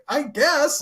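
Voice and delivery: a bewildered little yelp; baffled high reply. Retold or imitated. Answering the cafeteria mid-labor, squeaky bewildered reply. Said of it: "I was like".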